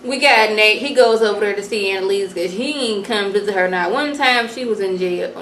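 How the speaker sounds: ordinary delivery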